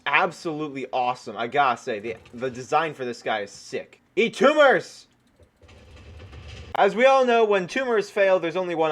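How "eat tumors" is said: battle cry. Real, not acted. Firing tumor weapon again.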